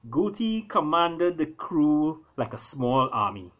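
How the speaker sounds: usual speaking voice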